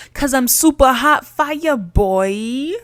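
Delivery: lightly done rapper flex; mock rapper line. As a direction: drawl it like a playful rap boast. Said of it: "But I'm not a rapper".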